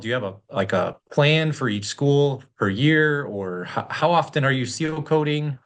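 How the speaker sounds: ordinary talk, nothing marked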